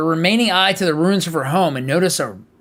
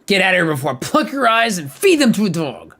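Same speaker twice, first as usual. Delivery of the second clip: menacing threat; 4 semitones higher, 5 dB louder than usual. Acted. Childhood terror threatening.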